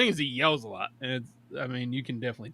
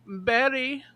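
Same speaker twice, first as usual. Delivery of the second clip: high, slow sing-song calling; calling mother; 10 semitones higher, slower than usual. Retold or imitated. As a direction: call the child in from the window, drawn out. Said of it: "she's going".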